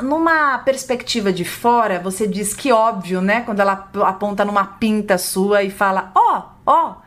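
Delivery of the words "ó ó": lightly voiced child, higher-pitched; short high exclamations, like a child pointing; imitating a pointing child. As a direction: call attention like a curious small child.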